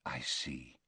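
low, soft and kind; gently understanding. A narrator's reading. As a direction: headmaster kindly reading the boy's fear. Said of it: "said Dumbledore kindly".

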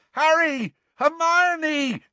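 high, ringing yell across a crowd. A narrator's reading. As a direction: shout their names in triumph. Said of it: "yelled Ron".

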